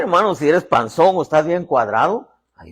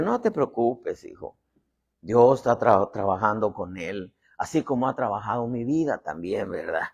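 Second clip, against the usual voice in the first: lower, softer, soothing; gentle reassurance; 4 semitones lower, 4 dB quieter than usual. Acted. Mother telling her son not to worry, gentle reassurance. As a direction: reassure softly, trailing down.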